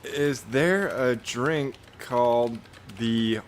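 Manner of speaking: slow dictation. Real, not acted. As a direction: spell it out slowly for a machine. Dictating a question to Grok.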